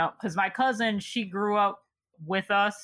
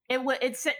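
ordinary talk

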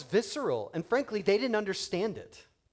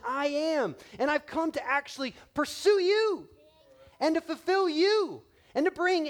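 higher and louder, building sermon cry; preaching at full force; 7 semitones higher, 3 dB louder than usual. Acted. Pastor urging the congregation to believe, full-force preaching. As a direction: proclaim it to the back row.

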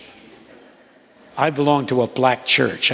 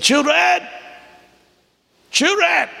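pastor calling; slower than usual. Retold or imitated. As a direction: sing out the word like an old pastor. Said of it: "And he said".

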